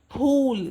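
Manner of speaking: फूल with a strong puff of air on फ; aspirated phool. Shown as a demonstration. Teacher showing a mother the breathy फ in फूल. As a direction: push the air out hard on फूल. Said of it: "ज़रा ज़ोर लगा के बोलेंगे".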